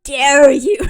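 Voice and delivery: loud, indignant mock outburst; mock outrage. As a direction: blurt it out like a scandalized heroine. Acting out her outraged reaction.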